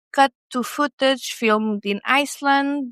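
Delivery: usual speaking voice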